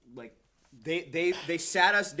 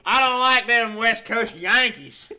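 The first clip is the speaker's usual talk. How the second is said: higher, louder slow Southern drawl; real Southern accent; 7 semitones higher, 12 dB louder, slower than usual. Acted. Holler local rejecting the Seattle helpers in a slow Southern drawl. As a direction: grumble it like an old Southern local. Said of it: "That's real Southern".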